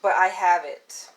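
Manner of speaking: normal talking voice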